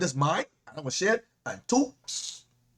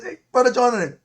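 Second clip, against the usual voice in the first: calling out; 6 semitones higher, 7 dB louder than usual. Retold or imitated. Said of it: "Oh, I see it".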